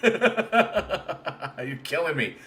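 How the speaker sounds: very slow, drawn out through laughter; laughing helplessly. Real, not acted. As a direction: let the laugh take over each word.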